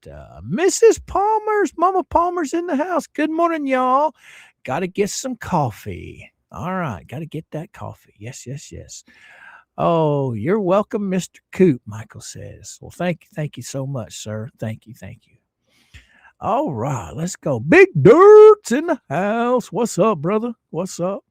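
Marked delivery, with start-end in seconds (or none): 0.4-4.1 pitched way up, calling out a welcome; excited high greeting. Real, not acted. Host greeting a regular viewer, high excited call. 17.6-21.2 hype announcer. Real, not acted. Host hyping a viewer's arrival, louder booming announcer call.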